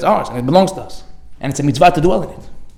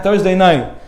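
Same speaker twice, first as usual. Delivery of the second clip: normal talking voice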